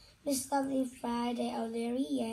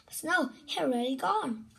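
plain reading voice

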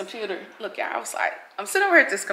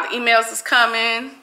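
ordinary talk, nothing marked

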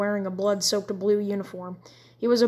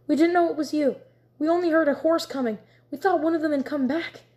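breathless relief; 7 semitones higher, 3 dB louder than usual. A narrator's reading. Emma relieved, rushing to him.

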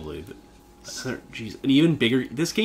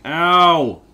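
pained yelp; 6 semitones higher, 10 dB louder than usual. Real, not acted. Character takes damage.

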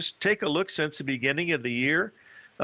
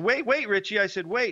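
grab his attention before he hangs up; urgent call to wait; 7 semitones higher than usual. Retold or imitated. Calling his broker back in a rush, urgently excited.

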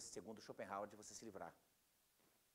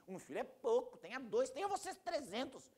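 high, loud acted exasperation; hammed-up outraged retort; mock indignation; 11 semitones higher, 4 dB louder, slower than usual. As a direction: escalate the protest, stretching the last words out high. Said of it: "Deixa a pessoa indignada".

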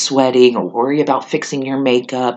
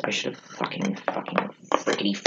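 whispered swearing under breath; muttered cursing; 3 semitones lower, 10 dB quieter than usual. Real, not acted. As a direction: hiss the curses to yourself. Muttered aside, cursing mistake.

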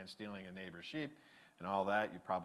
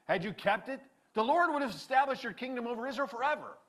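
loud, raised prophet pronouncing judgment; stern prophet's rebuke; 10 semitones higher, 7 dB louder than usual. Retold or imitated. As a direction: scold the king from on high. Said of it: "Samuel exclaimed".

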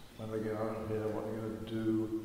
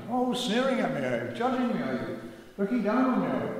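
much higher and louder, furious accusation; angry shouting; 9 semitones higher, 6 dB louder than usual. Retold or imitated. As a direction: hurl it back at him, outraged. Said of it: "he started shouting".

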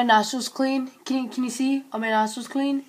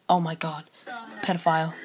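ordinary delivery

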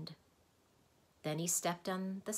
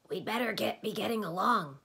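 Tok's voice; 5 semitones higher, 6 dB louder than usual. Acted. Tok's voice in the story.

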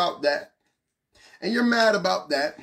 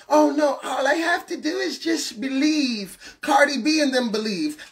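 mocking critics; 3 semitones higher than usual. Preacher mocking churchgoers who say belief is enough, sneering parody. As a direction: parody the lazy believer's excuse.

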